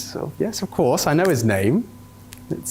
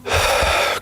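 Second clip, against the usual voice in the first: exasperated sigh. Acted. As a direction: let out a heavy, fed-up sigh. Host exasperated by name argument.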